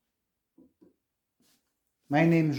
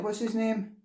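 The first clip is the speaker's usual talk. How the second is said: higher and softer, gently coaxing; coaxing a shy spirit to speak, higher and softer; 6 semitones higher, 4 dB quieter than usual. Real, not acted. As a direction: ask again higher and softer, coaxing an answer.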